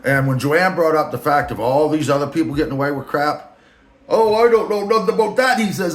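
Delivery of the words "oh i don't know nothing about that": much higher, louder, odd-toned mock of the boss; [name]'s voice. The boss brushing off Joanne, in a high mock voice. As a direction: play the boss dodging blame. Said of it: "I'm just filling in voice".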